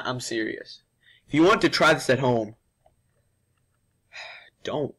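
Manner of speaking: usual speaking voice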